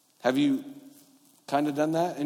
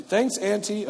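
put-on high-pitched dutiful kid thanks; child thanking relatives; 8 semitones higher, slower than usual. Child corrected by parent thanks Auntie, high and dutiful. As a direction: toss out thanks like a child at presents. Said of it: "when you have kids and they're opening up gifts".

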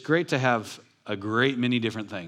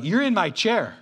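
high, hammed-up indignant protest; mock indignant; 9 semitones higher, 4 dB louder than usual. Churchgoer to someone in their familiar chair, mock indignant. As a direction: protest in mock outrage, pitch jumping up. Acted churchgoer finding seat taken.

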